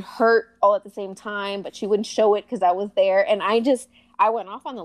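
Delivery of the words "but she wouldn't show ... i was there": ordinary delivery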